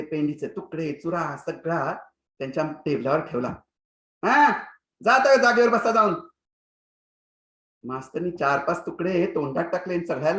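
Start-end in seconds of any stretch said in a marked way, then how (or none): 4.2-6.1 loud, dismissive teacher command; curt order. Acted. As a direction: send him back to his seat. Teacher orders pupil to seat. (मास्तरांचा मोठा हुकूमी आवाज)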